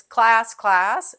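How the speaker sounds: loud sing-song call of class. Shown as a demonstration. Teacher calling her class to attention, loud sing-song call. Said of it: "and the class says".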